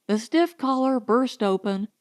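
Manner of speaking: plain reading voice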